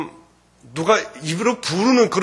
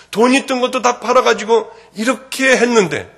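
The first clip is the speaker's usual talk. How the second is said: loud, high, impassioned sermon voice; testimony at full force; fervent preaching; 7 semitones higher, 8 dB louder than usual. Acted.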